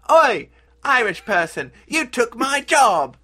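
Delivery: slow, high, loud British yell; hammed-up outraged British local; angry mock British. British local accusing an Irish immigrant, mock angry shout. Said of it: "the local British folks basically were like".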